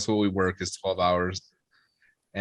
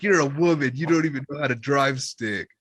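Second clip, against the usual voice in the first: higher, dismissive mock retort; drunk scoffing; 5 semitones higher than usual. Drunk son putting down mom's driving, scoffing. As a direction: imitate a drunk son scoffing at his mother.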